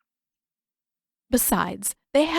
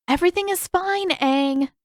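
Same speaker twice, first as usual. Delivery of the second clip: put-on higher, clipped girl voice brushing it off; dismissive girl; 10 semitones higher than usual. A narrator's reading. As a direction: wave him off. Katara brushes off Aang.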